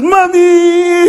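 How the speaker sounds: put-on high, loud childlike call; happy child calling. Child running to the parent, a high happy call. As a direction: call out like a delighted little kid.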